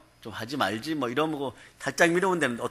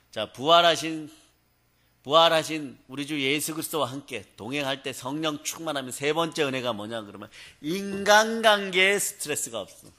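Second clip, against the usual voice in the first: ordinary talk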